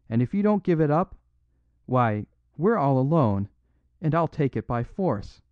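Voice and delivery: loud, threatening outlaw; furious outcry. A narrator's reading. Flambeau threatens the priest to hand over the cross, loud and angry. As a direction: bellow it at the little priest. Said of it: "He sprang to his feet with a gigantic gesture and cried".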